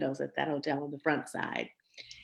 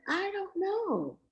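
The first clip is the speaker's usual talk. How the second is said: put-on timid young self; much slower than usual. Young self unsure, dad suspicious.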